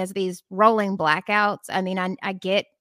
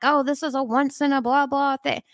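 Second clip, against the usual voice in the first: higher, lightly mocking officials; dismissive mocking quote; 6 semitones higher than usual. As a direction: toss it off like a tired cliché. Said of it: "I remember them being like".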